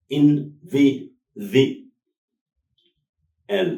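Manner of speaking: ኢን ቪ ዚ ኤል ተፈላልዩ; split into syllables. Shown as a demonstration. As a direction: tap out each syllable. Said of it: "ኣርባዕተ ሲላብል".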